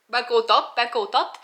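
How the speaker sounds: loud grumble with a Québécois twang; Québécois accent. Real, not acted. Vlogger protesting friends' remark on their accent, in Québécois accent. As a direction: slip into a Québécois accent, loudly. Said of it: "Pourquoi je parle d'accent québécois maintenant?".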